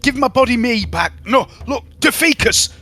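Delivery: high, confused Yorkshire plea, slowing down; Yorkshire lad begging for his body back; Yorkshire accent, high and bewildered; pleading Yorkshire accent. Acted. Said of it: "with a Yorkshire accent".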